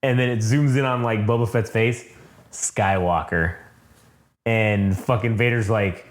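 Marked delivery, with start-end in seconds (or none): 2.5-3.6 drawn-out, low Skywalker; slow menacing name. Retold or imitated. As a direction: let the name hang. Boba Fett says the name.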